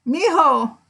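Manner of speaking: put-on high, drawn-out little old lady call. Retelling a grandmother calling out to her grandson, high old-lady voice. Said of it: "this little old lady comes out".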